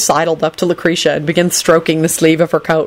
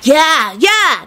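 high, eager yeahs; high-pitched enthusiastic agreement; enthusiastic holler; 9 semitones higher than usual. A narrator's reading. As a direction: cheer along like an eager kid. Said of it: "Nicholas hollered enthusiastically".

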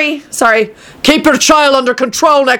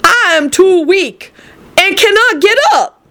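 frail grandmother; 8 semitones higher than usual. Acted. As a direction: sound too feeble to stand up. Grandmother speaking from her bed.

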